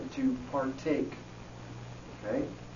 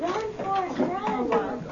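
mock movie cheer; much slower than usual. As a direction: cheer him down the hall like the movie. Jokingly cheering a running volunteer.

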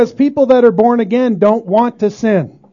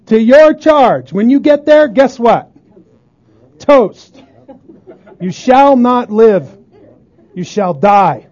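louder, slow, weighty warning; forceful preaching; 3 semitones higher, 5 dB louder than usual. Acted. Preacher warns listeners of judgment for one sin, thundering.

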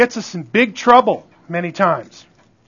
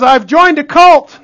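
loud, higher, slow joking exclamation; mock astonishment; 5 semitones higher, 6 dB louder, slower than usual. Preacher retelling a joke about the football game, mock-astonished. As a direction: draw out the comic realization, raised and loud. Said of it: "joking about the whole thing".

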